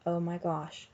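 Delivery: plain reading voice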